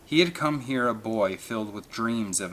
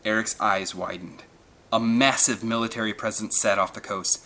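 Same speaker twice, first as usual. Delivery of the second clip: plain reading voice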